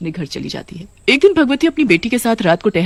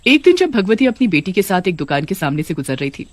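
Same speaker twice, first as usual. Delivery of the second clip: usual speaking voice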